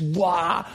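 a lightly voiced truck roar; imitated engine rev. Truck revving beside the preacher, mock engine noise. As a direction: rev it like a diesel. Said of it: "just making a lot of noise".

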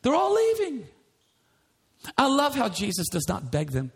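ordinary talk, nothing marked

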